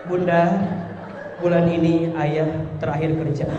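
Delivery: husband telling his wife he lost his job, low and quiet; worried husband. Retold or imitated. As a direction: confess the job loss in a low voice. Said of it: "Ini mah kadang-kadang".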